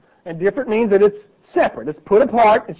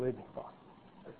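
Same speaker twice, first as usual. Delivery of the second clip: awed whisper; 6 semitones lower, 23 dB quieter, slower than usual. Acted. Believer's awed words to God.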